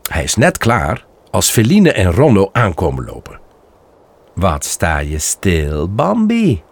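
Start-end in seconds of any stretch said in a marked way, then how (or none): 4.3-6.6 teasing. Acted. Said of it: "zegt Ronno pesterig".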